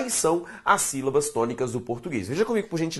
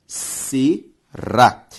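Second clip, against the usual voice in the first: "será" in two parts, se... rá; syllable by syllable; 5 dB louder than usual. Shown as a demonstration. Teacher dividing a word for the class, syllable by syllable. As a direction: separate "será" with a clear pause after se. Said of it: "Se eu separo será".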